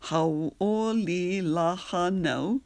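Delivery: slow syllable-by-syllable demo; six clear syllables. Shown as a demonstration. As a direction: draw out each syllable for learners. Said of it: "That's six syllables altogether".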